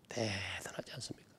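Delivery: whispered in awe; awed whisper. Real, not acted. Pastor marveling at mothers' strength, whispered. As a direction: breathe it like a secret marvel.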